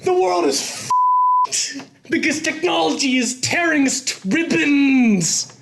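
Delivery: high, fast frantic ranting; Nick Cage rant. Acted. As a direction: rant wildly like Nicolas Cage. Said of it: "calm down, Nick Cage".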